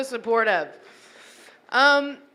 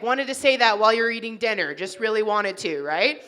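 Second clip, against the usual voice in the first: ordinary delivery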